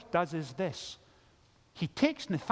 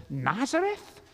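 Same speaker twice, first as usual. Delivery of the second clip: higher, rising doubtful question; incredulous; 5 semitones higher than usual. Acted. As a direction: act out baffled disbelief. Nathanael doubting, acted by preacher.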